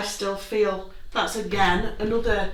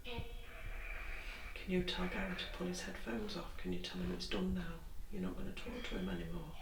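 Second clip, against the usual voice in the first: whispering; 17 dB quieter than usual. Real, not acted. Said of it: "I've been really whispering".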